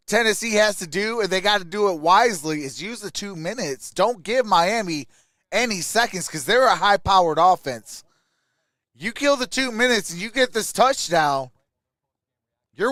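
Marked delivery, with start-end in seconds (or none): none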